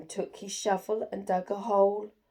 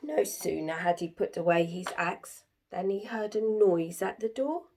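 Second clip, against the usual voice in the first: ordinary delivery, nothing marked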